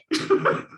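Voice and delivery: a quick unvoiced laugh; breathy laugh. Real, not acted. Telling friends about her fire, breathy laugh. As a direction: laugh off the memory.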